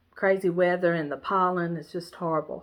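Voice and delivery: ordinary delivery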